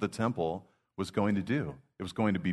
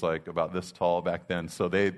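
ordinary talk

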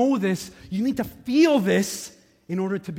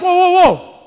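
high, very loud halting cry; yelling; 12 semitones higher, 14 dB louder than usual. Retold or imitated. Pastor to a man cutting in line, yelling. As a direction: yell to stop someone in his tracks. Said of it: "But I started yelling".

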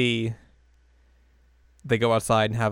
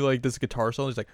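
ordinary talk, nothing marked

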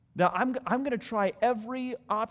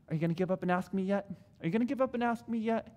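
playful God; much faster than usual. Retold or imitated. God voiced teasing.

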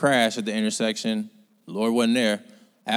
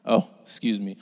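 normal talking voice

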